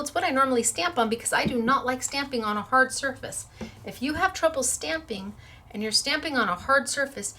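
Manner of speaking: normal talking voice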